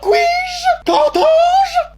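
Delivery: high, loud, scandalised gasping cry; outraged shock. Acted. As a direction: clutch your pearls, aghast. Mother outraged at son's slang.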